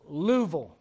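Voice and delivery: Tennessee "Louisville" squeezed to two syllables, Luh-vul; Tennessee accent. Shown as a demonstration. Pastor showing the local Tennessee way to say Louisville. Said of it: "people talk a little bit different there".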